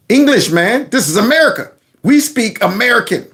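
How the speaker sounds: high-pitched indignant American; mock patriot. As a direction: insist like an affronted English-only patriot. Acts out stereotyped English-only patriot.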